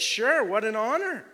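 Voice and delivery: high, loud, thrilled acceptance; delighted. Acted. Zacchaeus answering Jesus, high and loud with delight. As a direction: gush it out like a dream come true. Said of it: "He's like".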